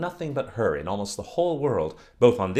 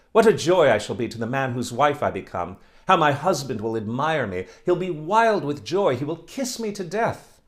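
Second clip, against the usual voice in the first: higher and louder, rapturous; gushing delight; 5 semitones higher, 7 dB louder than usual. Acted. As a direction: dream aloud of an admiring husband. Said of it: "she cried out".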